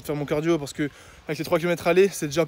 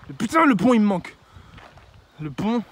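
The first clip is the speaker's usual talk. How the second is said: loud, high outburst; excited exclaim; 5 semitones higher, 6 dB louder than usual. Real, not acted. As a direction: burst out missing it. Exclaims missing the bridge.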